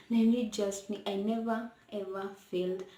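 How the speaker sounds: ordinary talk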